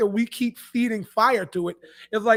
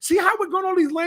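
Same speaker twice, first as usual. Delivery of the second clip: raised voice; 7 semitones higher than usual. Real, not acted. Raised over interruptions.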